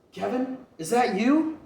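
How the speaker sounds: mother calling to her son. Acted. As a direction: call his name in joyful disbelief. Mother calls from afar.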